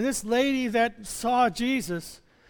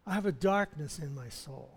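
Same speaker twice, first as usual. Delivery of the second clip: hushed repeat; 7 semitones lower, 7 dB quieter than usual. Retold or imitated. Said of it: "I go".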